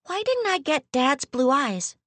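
lightly voiced young girl; childlike voice. Child Eunice's questions.

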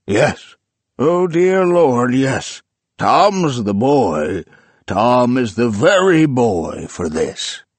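loud, slow, rapturous; elated murmur to himself. A narrator's reading. Old man alone with his books, loud slow rapture. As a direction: savor the dream with fervent delight.

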